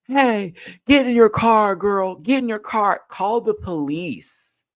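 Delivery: high, loud mock bystanders yelling; mimicking the bystanders at the road rage scene, high and loud; bystanders calling out. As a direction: play the useless men shouting from a distance. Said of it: "all these men were doing were".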